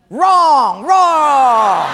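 loud buzzer-like "wrong"; loud rejection. Acted. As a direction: shoot it down hard. Personnel guy rejects answer.

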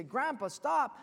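high, loud cry to stop; urgent warning. Acted. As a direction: call out to halt him at the edge. Last warning before the ledge.

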